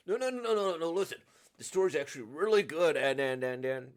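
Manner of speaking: loud, rushed, stammering defence; acted panicky backpedalling; flustered protest. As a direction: rush it out loudly, tripping over words. Flustered character defends the game's story.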